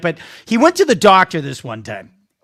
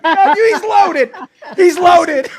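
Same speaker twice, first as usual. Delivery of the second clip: laughing; 8 semitones higher, slower than usual. Real, not acted. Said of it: "I'm like".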